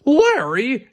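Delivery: high, loud startled cry of a name; exclaimed. Acted. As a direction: blurt the name in shock, up high. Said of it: "exclaimed Bob".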